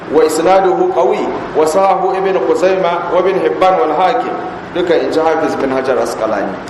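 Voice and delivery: usual speaking voice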